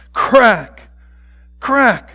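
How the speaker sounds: high, loud, sudden burst; sharp exclamation. A narrator's reading. Narrator voices the belt striking hands, sharp and loud. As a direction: punch it out like a blow landing.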